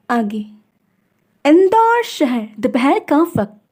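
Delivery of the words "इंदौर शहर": plain reading voice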